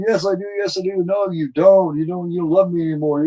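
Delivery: high, loud mock quarrel; yelling accusations in two voices; retold yelling argument. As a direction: shout it back and forth. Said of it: "starts yelling at her".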